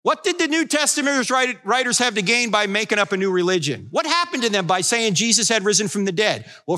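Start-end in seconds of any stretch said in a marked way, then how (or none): none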